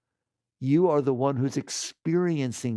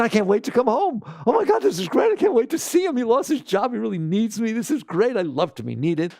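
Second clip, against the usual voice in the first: mock-gushing, much higher and brighter; cheerful mind voice; 6 semitones higher than usual. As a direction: gush like a happy wife glad to be needed.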